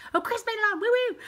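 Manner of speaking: high, whooping welcome; delighted high-pitched whoop; excited cheer. Real, not acted. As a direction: cheer a friend's arrival, pitch way up. Cheers a viewer joining live.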